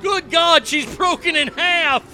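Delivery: sportscaster calling a disaster, mock excited; mock play-by-play. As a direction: call the fall like a ringside announcer gone wild. Mock commentary over circus video.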